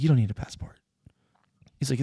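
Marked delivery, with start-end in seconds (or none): 0.0-0.8 whispered aside. Retold or imitated.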